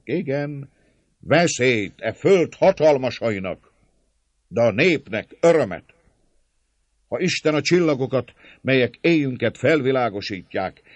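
loud, high-pitched declamation to a crowd; rousing speech with punched opening words; fervent crowd orator. A narrator's reading. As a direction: proclaim it like a popular preacher.

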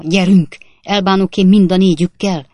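loud fighting shout; belligerent challenge. A narrator's reading. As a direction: take on all four, fists up. Cabman challenges them to fight.

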